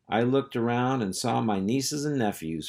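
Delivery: plain reading voice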